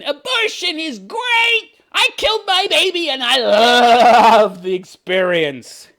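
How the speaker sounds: high, slow, hammed-up protester chant; mock protester yell. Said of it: "march through the streets yelling".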